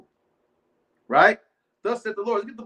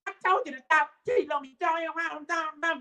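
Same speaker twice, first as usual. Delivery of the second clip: mock wife scolding; 6 semitones higher than usual. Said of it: "That Yvette spirit".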